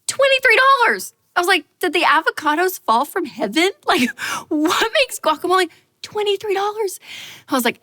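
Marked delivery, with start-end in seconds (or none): 0.1-1.1 much higher and louder, incredulous; outraged disbelief. Retold or imitated. Repeating the guacamole price, high, loud and outraged. 1.8-7.0 higher, mock-outraged questioning; incredulous rant. Ranting at a menu price, high and mock-outraged.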